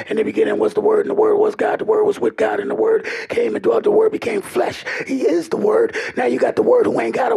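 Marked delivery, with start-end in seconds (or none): 0.1-4.8 high, ringing scripture quote; scripture recitation. Acted. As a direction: proclaim the verse lifted up high.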